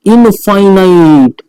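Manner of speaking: slow, long-stretched syllables; drawn-out answer. Shown as a demonstration. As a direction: announce the key word, dragging it out. Teacher gives the stretched answer. (खींचकर बोला गया जवाब)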